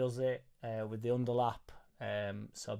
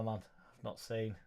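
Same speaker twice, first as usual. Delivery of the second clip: ordinary talk, nothing marked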